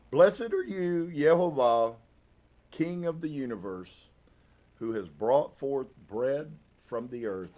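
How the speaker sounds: ceremonial prayer opening high and loud, easing down; blessing recitation. Acted. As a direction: lift it up as a spoken prayer. Recites English translation of the blessing.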